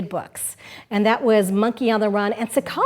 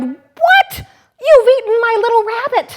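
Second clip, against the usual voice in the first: much higher and louder, fast shocked cry; doctor cries out; 12 semitones higher, 8 dB louder than usual. Acted. Doctor crying out at the wolf in the story, shocked shout. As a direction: yelp it out in alarm. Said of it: "The doctor cried".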